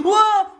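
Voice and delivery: high, loud scream of real fright; jumpscare scream. Real, not acted. Gamer jumpscared by Wizard Foxy, screaming in fright. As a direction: yelp as it leaps out.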